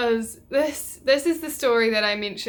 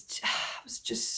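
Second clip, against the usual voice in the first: breathy whisper after a sigh; whispered as if too ashamed to say it aloud; 9 dB quieter than usual. Real, not acted. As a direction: let it out on a defeated sigh. Reliving the tractor accident.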